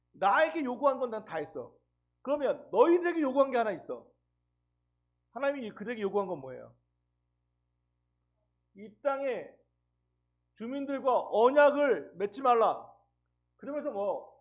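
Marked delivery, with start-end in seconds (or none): 0.1-4.1 louder, firm divine declaration; God voiced with a commanding, louder delivery; God declaring, raised voice. God's words voiced in sermon. 8.8-12.9 slow, louder solemn command; God forbidding the covenant, slow and loud; stern command. Acted. God's command voiced in sermon.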